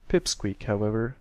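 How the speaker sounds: ordinary delivery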